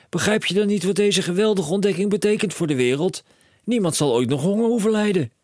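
high and loud, racing with plans; a dad who sees a fortune; excited cry. A narrator's reading. Father telling his son of riches, high excited call.

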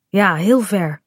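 ordinary delivery

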